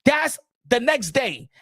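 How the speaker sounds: normal talking voice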